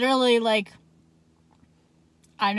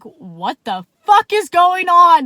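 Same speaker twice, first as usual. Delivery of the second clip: loud, slow, outraged demand; exasperated yell; 8 semitones higher, 12 dB louder, slower than usual. Retold or imitated. Yelling at buddy Jacob over the cop stop, outraged. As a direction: slam the question at him, drawn out.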